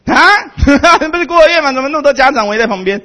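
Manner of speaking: 惊讶地高声喊; 假装大吃一惊地高声问; mock surprise. (高声惊讶地问)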